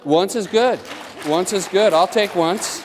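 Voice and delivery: high, loud and emphatic, approving; emphatic approval. Real, not acted. Instructor calling out approval to the class, loud and high. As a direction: call it out across the room so everyone hears. Answering student who tied once.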